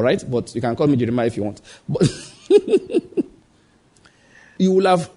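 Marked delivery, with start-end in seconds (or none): 2.5-3.2 a quick high chuckle at his own joke; short high laugh. Real, not acted. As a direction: laugh briefly, amused.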